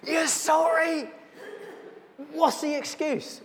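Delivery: high, slow incredulous shout; angry boss. Retold or imitated. Charge hand shouting at the worker over the broken mould, incredulous. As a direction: bark back his words in disbelief.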